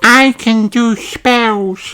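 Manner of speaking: little witch cat. Acted. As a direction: play the spooky little witch.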